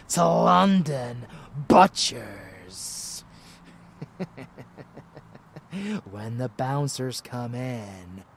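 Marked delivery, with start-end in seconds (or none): 0.1-3.2 loud, gloating proclamation; menacing relish. Acted. Relishing the planned slaughter. 3.3-6.1 soft gloating villain chuckle; sinister chuckle. Acted. Relishing the planned slaughter.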